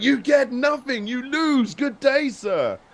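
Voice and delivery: high, slow mock scream; Willy Wonka. Guest re-enacts the film quote ending his video, mock scream. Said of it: "Willy Wonka screaming".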